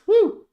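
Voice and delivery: short, very high, loud woo; celebratory whoop. Real, not acted. Streamer gets the targets bet, celebratory whoop. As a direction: let out a sharp joyful whoop.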